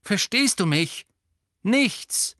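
laut und hoch nachsetzen; scharfe, laute Ermahnung; streng. A narrator's reading. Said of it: "sagte Lupin streng". (mit lauter Strenge)

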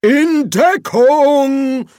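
loud, high, drawn-out yell; shouted warning. Acted. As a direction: bellow for everyone to take cover. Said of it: "rief der Erzkanzler".

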